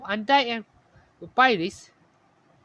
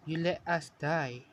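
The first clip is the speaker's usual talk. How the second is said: low, soft blame; hushed, lowered reproach; quiet accusation; 3 semitones lower, 6 dB quieter than usual. A narrator's reading. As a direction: accuse them softly and coldly. Ghostly sister accuses the dreamer.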